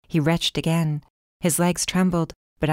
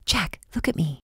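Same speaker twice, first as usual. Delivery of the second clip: whispered plea. Acted. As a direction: whisper to reach him through fever. Urging feverish Jack to focus.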